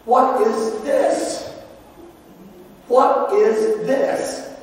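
high, slow, indignant shout of disbelief; older brother yelling at his father, high and drawn out; angry yell. Retold or imitated. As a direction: hurl the question at the father, outraged. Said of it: "he yells at his father".

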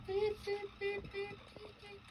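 high beep beep sounds; squeaky toy beeps; beeping noise. Pretend.

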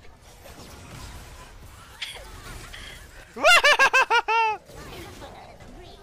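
high piercing laugh burst. Real, not acted. Game chaos, loud laugh.

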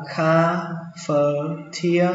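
"cafeteria" slowly broken into ca-fơ-tia-ri-ơ; syllable by syllable. Shown as a demonstration. Teacher first models the word for learners, slow and syllabified. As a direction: stretch out each syllable of "cafeteria".

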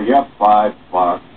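normal talking voice

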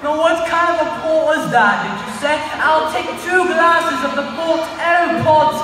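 refined British diner ordering port; high-class British. Shown as a demonstration. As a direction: draw it out in upper-class British. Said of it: "high-class British accent sounds sophisticated".

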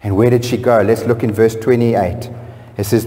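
ordinary talk, nothing marked

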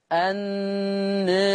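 very drawn-out النار, aa near e, thin final r; level, held النار with a softened r; taqlil, light ra. Shown as a demonstration. Teacher showing the thinned ra to students, النار with taqlil. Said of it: "رققت الراء".

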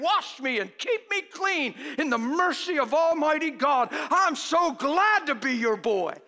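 mock thankful prayer. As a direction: pray it out loud like you mean it. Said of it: "why don't you just say".

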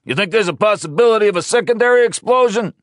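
loud, high, fast question; shouted question. Acted. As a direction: call it in fast.

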